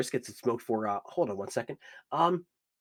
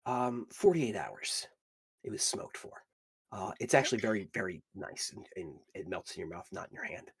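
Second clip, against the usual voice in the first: hushed bartender; 3 semitones lower, 6 dB quieter than usual. Acted. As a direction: lean in and murmur it. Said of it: "and he goes".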